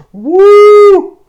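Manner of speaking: very high, loud cheer; joyful whoop. Retold or imitated. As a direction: shout out in pure delight. Girl cheering over gifts.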